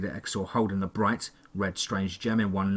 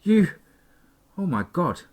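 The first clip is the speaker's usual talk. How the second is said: stammered; 7 semitones higher than usual. A narrator's reading. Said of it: "I stammered".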